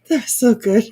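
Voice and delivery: high, loud amusement; laughing. Real, not acted. Reacting to co-host's dig at Laura, laughing. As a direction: get it out between big laughs.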